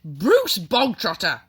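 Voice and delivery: loud, slow bark of a name; barked summons. A narrator's reading. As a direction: bark the name like a drill sergeant. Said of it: "the Trunchbull barked suddenly".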